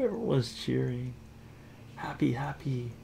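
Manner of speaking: ordinary delivery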